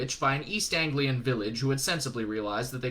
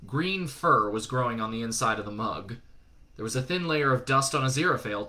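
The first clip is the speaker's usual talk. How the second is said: ordinary delivery, nothing marked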